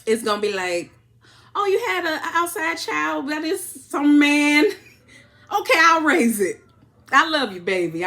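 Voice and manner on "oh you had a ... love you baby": mock forgiving husband. Mimics a man forgiving a cheater.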